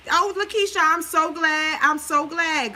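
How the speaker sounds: high, loud, delighted hello to a viewer; light up, thrilled she showed up; excited greeting. Real, not acted. Greeting viewer Lakeisha in the comments, excited and delighted.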